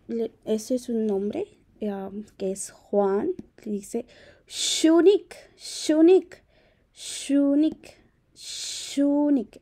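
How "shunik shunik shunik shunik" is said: "Shunik" with a long hushed sh and a clear final k; "Shunik" pronounced carefully, sh then a crisp k; pronunciation demo. Shown as a demonstration. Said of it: "así se pronuncia".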